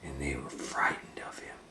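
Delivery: soft hushed storytelling; story whisper. A narrator's reading.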